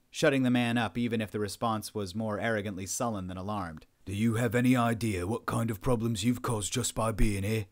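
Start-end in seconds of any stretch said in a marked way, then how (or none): 4.1-7.7 stern, forceful dressing-down; angry officer rebuking a captive; angry interrogator. Acted. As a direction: confront the prisoner with controlled anger. Powell angrily dresses down prisoner.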